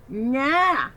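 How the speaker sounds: loud, high, firm nah; emphatic. Acted. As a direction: refuse with finality. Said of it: "my sister said emphatically".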